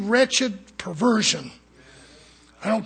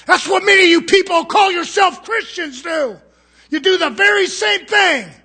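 shouted fire-and-brimstone preaching, higher and louder; preaching at full force; 7 semitones higher, 7 dB louder than usual. Real, not acted. Preacher shouting at the church about compromise. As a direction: thunder it at the congregation.

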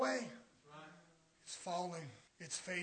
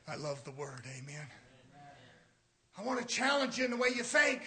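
ordinary talk

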